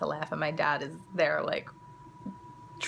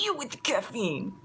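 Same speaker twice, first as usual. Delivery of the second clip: imitated kid in a raised-pitch mock-Italian accent; thick Italian accent; 5 semitones higher than usual. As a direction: accuse in a put-on Italian accent. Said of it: "In the thickest Italian accent".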